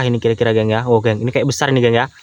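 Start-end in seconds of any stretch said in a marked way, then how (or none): none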